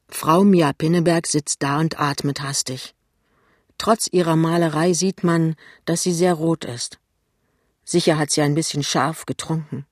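ordinary delivery

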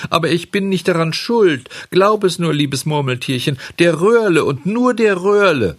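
high, loud, frantic protest; Bengele. A narrator's reading. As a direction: blame someone else, insistent and whiny in a puppet voice. (aufgeregt beteuernd, mit hoher Stimme)

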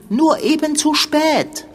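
high, slow, emphatic jab; high, pointed, superior reply; pointed retort. Acted. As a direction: stretch it out to rub it in. Quarrel over blame.

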